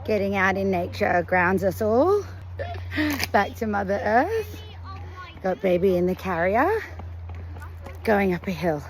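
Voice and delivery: low, calm voiceover narration; vlog voiceover. Acted. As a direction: narrate the walk evenly.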